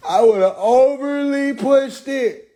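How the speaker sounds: high, slow laughing declaration. Real, not acted. Joking he would have pushed it, laughing. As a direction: stretch it out through laughter.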